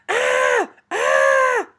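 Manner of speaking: loud, high-pitched "Ah!" screeches. A narrator's reading. Child in clown costume screeching at trick-or-treaters, loud screech. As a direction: screech out two long cries. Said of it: "I screeched".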